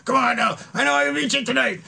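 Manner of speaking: very high, fast, loud put-on voice; imitated goading challenge. Friend demanding an arm wrestle at night, high mock goading.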